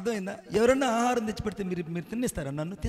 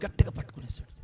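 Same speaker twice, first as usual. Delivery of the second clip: whispered, as if seizing someone in secret; sudden whisper. Acted. Preacher whispers how the soldier seized Jesus, whispered. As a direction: hiss the grab under the breath.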